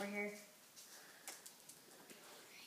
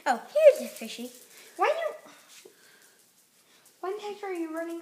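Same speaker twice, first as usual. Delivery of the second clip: excited; 4 semitones higher, 8 dB louder than usual. Pretend. Dog runs at them.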